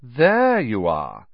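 slow, lazy drawl. A narrator's reading. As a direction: stretch it out, bored and superior. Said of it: "he drawled".

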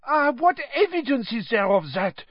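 put-on high French-accented challenge; Madame Maxime. A narrator's reading. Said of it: "said Madame Maxime".

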